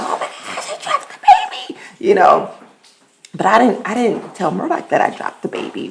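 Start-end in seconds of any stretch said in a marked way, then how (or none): 0.0-0.7 whispered in alarm; panicked whisper. Retold or imitated. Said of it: "I was like". 0.7-1.8 much higher, panicked; a hammed-up panic on dropping the baby; panicked squeal. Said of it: "I was like".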